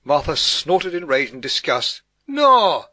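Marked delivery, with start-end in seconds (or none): 2.3-2.9 high, very loud outburst; exploding in exasperation; exasperated shout. A narrator's reading. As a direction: shout it out, fed up. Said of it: "she shouted in exasperation".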